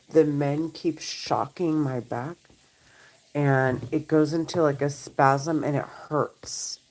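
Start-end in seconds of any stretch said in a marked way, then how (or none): 5.6-6.8 breathy hushed admission of pain; pained whisper. Real, not acted. Telling viewers her back spasms hurt, whispered in pain. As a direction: let the words fall to a pained whisper.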